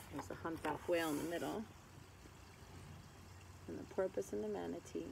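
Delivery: ordinary talk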